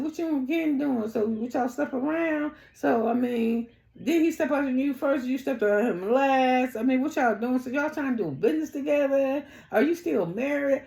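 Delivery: high, nosy rapid-fire questions; mimicked interrogation. Acting out Tisha's prying interrogation.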